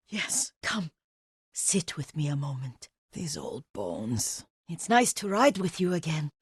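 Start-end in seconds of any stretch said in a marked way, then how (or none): 3.1-4.4 weary. Acted. Old servant sighs at her age.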